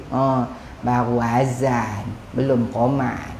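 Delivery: low, soft, slow lazy mumble; husband lazily putting off his wife; lazy brush-off. Retold or imitated. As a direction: brush her off without moving.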